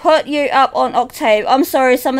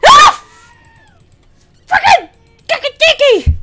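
startled shriek; 14 semitones higher, 3 dB louder than usual. Real, not acted. Startled by game noise.

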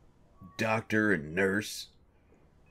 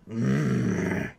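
normal talking voice

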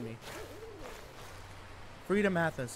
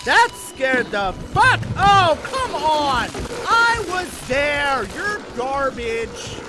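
loud, high, slow outraged shouts; furious yelling; 9 semitones higher, 10 dB louder, much slower than usual. Real, not acted. Player dies and yells at the game in fury. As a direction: rant at the game at full volume.

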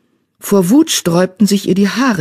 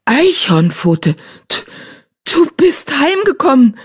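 high, louder stammered welcome; stammering; 10 semitones higher, 3 dB louder than usual. Acted. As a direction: stumble over the words in disbelief. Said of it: "stammelte sie".

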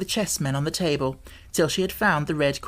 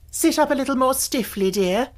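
merry; 7 semitones higher than usual. A narrator's reading. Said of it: "Alice cried with a merry laugh".

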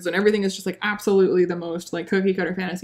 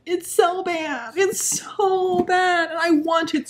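higher, very slow, wailing; drawn-out despair; 8 semitones higher, much slower than usual. Real, not acted. Reviewer wailing about how bad the book is. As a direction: stretch it out in despair.

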